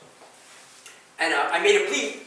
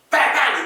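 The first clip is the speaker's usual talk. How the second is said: two loud, high bangs; imitated gunshots; 6 semitones higher, 8 dB louder than usual. Man retelling being shot, imitated gunshots. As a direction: punch out two sudden shots.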